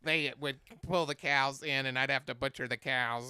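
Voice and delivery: mock alien monologue voice. Storyteller imitating classmate's K-Pax monologue, stupid alien voice. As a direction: imitate a silly E.T. reciting lines. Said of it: "an E T voice".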